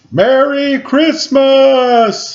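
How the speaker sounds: slow, high, loud long call; shouted. A narrator's reading. Conductor shouting from the moving train, slow and loud. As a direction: stretch it out, calling across the distance. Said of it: "he shouted".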